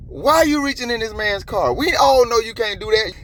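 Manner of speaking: high-pitched, exasperated challenge; indignant rant. Real, not acted. Vlogger challenging police over a car search, indignant rant.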